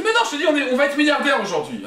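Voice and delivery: high-pitched, eager insistence; excited protest. Acted. Husband promising his wife riches, excited high-pitched protest.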